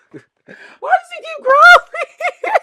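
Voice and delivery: high, loud, slow, laughing shout; shrieking laughter. Real, not acted. Reader shrieking with laughter at the growling. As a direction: yell it through laughter.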